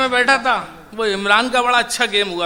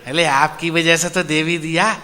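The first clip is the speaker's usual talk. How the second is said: hen's answer; 4 semitones lower than usual. Retold or imitated. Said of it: "मैंने उससे पूछ लिया".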